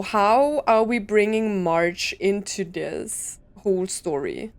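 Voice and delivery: ordinary delivery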